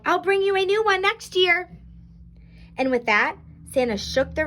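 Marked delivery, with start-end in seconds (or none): 0.1-1.8 high, sustained call; raised-pitch storybook farewell call. A narrator's reading. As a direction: call it out as if departing. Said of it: "Santa called".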